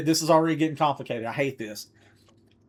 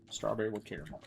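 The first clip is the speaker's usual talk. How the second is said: much softer, to himself; muttered to self; 5 semitones lower, 12 dB quieter, faster than usual. Real, not acted. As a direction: mutter the order under your breath. Repeating order softly to self.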